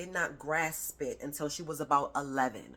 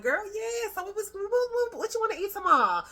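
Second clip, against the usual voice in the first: much higher, bubbly gossip voice on the phone; chatty phone voice; 5 dB louder than usual. Retold or imitated. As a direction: gush to a girlfriend on the phone. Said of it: "somebody call and you be like".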